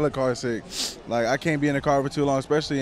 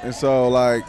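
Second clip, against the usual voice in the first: usual speaking voice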